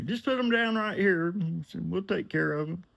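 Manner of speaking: high, breezy rich man; high client voice. Retold or imitated. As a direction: pitch way up and wave it off. John voiced giving directions.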